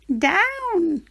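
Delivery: high, urgent witch call; a witch ordering the broom to land; witch crying out. Acted. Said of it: "cried the witch".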